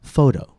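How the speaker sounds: "Photo" starting high and long, then dropping; stress on first syllable. Shown as a demonstration. Teacher repeating the example for learners, "Photo" stressed on its first syllable. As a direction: raise and stretch the first vowel of "Photo". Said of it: "the vowel is longer and the pitch is higher".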